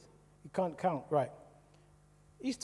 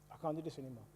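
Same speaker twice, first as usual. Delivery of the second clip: softer, defeated repeat of I can't do this anymore; quiet despair; 10 dB quieter, much faster than usual. Retold or imitated. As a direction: let it sink, barely above a murmur.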